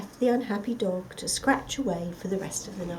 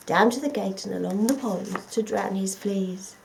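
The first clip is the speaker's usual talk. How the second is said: usual speaking voice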